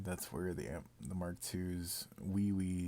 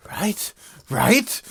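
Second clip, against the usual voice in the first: excited shout; 12 semitones higher, 18 dB louder than usual. Real, not acted. Excited rhetorical outburst.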